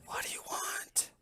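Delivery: eerie unseen whisper; a disembodied shopkeeper whisper. Acted. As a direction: breathe it from somewhere hidden. Hidden gnome voice from shop.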